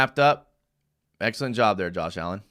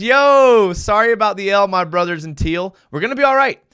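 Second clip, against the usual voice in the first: higher, louder pumped-up reading; hyped fan read; 6 semitones higher, 7 dB louder than usual. Retold or imitated. Reading Brandon's comment, pumped-up fan voice. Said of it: "he says".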